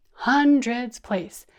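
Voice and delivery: big slow classroom chant; bigger voice. Shown as a demonstration. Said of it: "try that with me".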